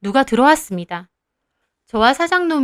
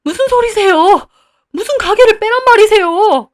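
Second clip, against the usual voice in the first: very high, loud alarm; shocked protest; 9 semitones higher, 8 dB louder than usual. Acted. Owner learning he must leave the shop, very high and loud. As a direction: cry out in alarm. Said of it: "사장놈이 놀라더라고요".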